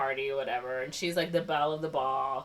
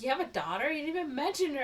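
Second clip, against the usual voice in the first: mock surprise; 8 semitones higher, 4 dB quieter, faster than usual. Acted surprised guests questioning her.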